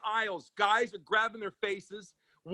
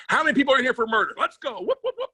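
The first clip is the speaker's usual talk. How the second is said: fast, louder, rising to a high whoop; crowd hype call; 8 semitones higher, 5 dB louder, faster than usual. Acted. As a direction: pump up the room like a hype man. Hyping up a prison crowd.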